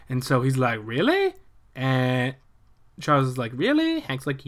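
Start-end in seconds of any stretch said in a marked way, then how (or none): 0.8-1.3 high, rising "Really?"; mock surprise. Charles hearing about Cairo, high rising surprise. 3.5-4.0 high, rising "Really?"; mock surprise. Charles hearing about Cairo, high rising surprise.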